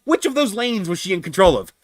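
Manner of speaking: high-pitched indignant question; retold angry demand. Driver demanding an answer about lanes, pissed off. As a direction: press the point, exasperated. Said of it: "I was pissed off to no end".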